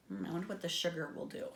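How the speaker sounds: ordinary delivery, nothing marked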